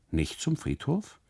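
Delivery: hoch, verwunderte Rückfrage; ungläubig nachfragen, die Stimme steigt; erstaunt. A narrator's reading. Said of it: "fragt er erstaunt". (erstaunte Frage des Kustoden, deutlich höher gesetzt)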